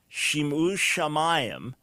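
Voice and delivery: "Shim'u shamayim" slow, leaning on the sh sounds; alliteration shown. Shown as a demonstration. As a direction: draw out the "Shim" and "Sham" so the echo rings. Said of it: "So you hear the Shim Sham".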